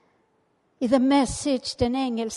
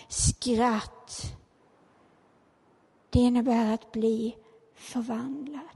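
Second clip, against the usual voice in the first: usual speaking voice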